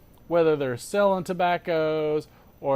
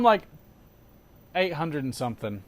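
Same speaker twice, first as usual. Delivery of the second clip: ordinary talk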